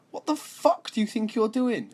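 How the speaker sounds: high, shrill-edged mock outrage; retelling the girl's angry yell, pitched high. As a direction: imitate her furious squeal at the shooter. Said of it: "she said".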